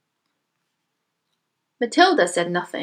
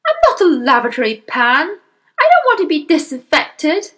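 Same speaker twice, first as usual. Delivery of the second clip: loud, raised, indignant protest; husband crying out; 7 semitones higher, 6 dB louder than usual. Acted. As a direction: protest loudly, in alarm. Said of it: "the husband cried".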